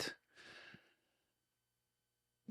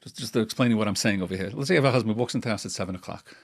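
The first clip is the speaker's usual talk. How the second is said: usual speaking voice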